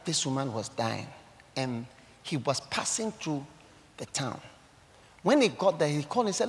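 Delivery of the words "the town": normal talking voice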